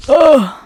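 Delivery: loud sharp grunt of pain. Acted. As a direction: grunt as if hitting the floor. Character falls in story.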